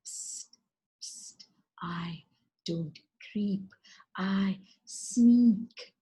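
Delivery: slither the words out sneakily; whispering lizard. Acted.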